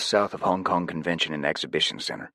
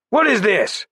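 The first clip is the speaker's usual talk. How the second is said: much higher, louder terse call; much higher, louder terse demand; 8 dB louder than usual. A narrator's reading. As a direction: snap it out to the crowd. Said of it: "Jed called out tersely".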